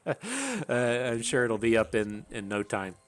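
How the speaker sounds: normal talking voice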